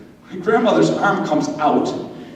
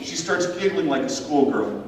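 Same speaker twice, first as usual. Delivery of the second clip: usual speaking voice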